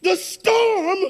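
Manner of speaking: fervent, tearful pulpit delivery; preaching with a cry. Acted. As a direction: cry the line out to the church. Preaching, crying tone.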